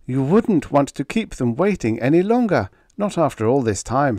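high, insistent pleading; desperate persuasion. A narrator's reading. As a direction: press him urgently. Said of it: "Kirk said desperately".

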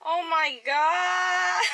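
shocked cry. Real, not acted. Seeing the grandpa crash, slow shocked cry. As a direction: let the shock stretch out.

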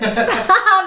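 bursting out laughing at his flop; a high, loud, real laugh. Real, not acted. Laughing at his own unfunny video, loud laugh.

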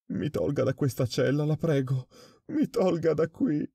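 high, rising desperate begging, slowing at the end; terrified pleading. A narrator's reading. Prisoner begging the director to take him out, terrified. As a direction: plead for your life, voice climbing. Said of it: "implorò".

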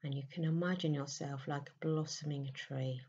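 soft, unhurried meditation voice with long pauses; guided meditation. Acted. Leading listeners into a May meditation, soft and soothing.

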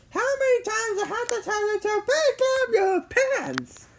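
high-pitched exasperated mom voice; scolding mom. A narrator's reading. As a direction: scold your son in a high, strained voice. Mom scolding son in story.

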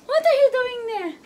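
high laughing relief on finding her. Real, not acted. Finds baby hiding, laughing relief. (natatawang ginhawa)

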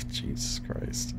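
hushed scared whisper. Real, not acted. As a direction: breathe it out in fear. Scared, hiding alone.

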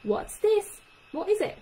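very high, loud quizzing What's this? What is it?; testing questions. Shown as a demonstration. Speech therapist showing parents quizzing a child, high. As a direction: press the child for an answer. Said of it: "you're asking them to say".

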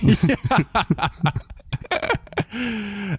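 long, high burst of laughter; real high laughter. Real, not acted. Laughing at the joke.

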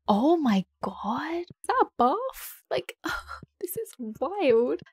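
slow, swooping astonishment; drawn-out awe, leaping high; amazed. Real, not acted. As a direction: stretch each word in disbelief. Amazed at the huge house.

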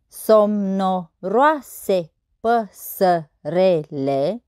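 very slow, each syllable separated. Shown as a demonstration. Teacher counting verse syllables on fingers, syllable by syllable. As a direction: count out the syllables one at a time. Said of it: "Să observăm numărând".

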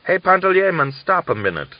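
loud, high call down the road. Acted. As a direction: call out to stop him. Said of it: "he called to Panteleimon".

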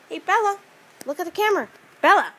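calling the dog. Real, not acted. Calling the puppy to camera.

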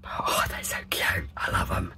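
breathy low whisper; scary whisper. Real, not acted. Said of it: "Excuse my scary voice".

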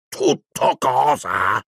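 stretched-out roar of indignation; Hagrid, thundering. A narrator's reading. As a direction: draw each word out in thunderous disbelief. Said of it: "tuonò Hagrid".

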